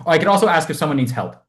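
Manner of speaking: usual speaking voice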